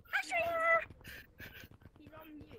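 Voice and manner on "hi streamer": loud high shout. Pretend. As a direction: yell the greeting out. Shouted greeting.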